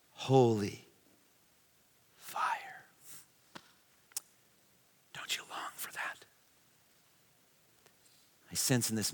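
hushed awe, almost no voice; reverent whisper. Real, not acted. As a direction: breathe out holy fire softly. Preacher's repeated reverent refrain.

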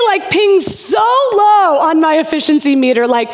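normal talking voice

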